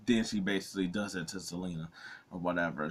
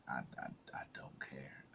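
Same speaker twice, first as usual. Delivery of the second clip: whispered; 6 semitones lower, 16 dB quieter than usual. Retold or imitated. Inner reaction to Sami talking.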